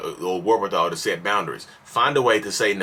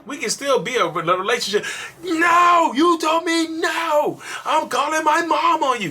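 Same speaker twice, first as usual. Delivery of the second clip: whiny furious yell; slower than usual. Retold or imitated. Spoiled partner threatens to call mom, bratty shout. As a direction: throw a tantrum at being refused.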